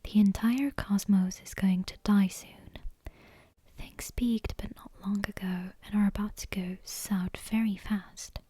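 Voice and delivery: ordinary delivery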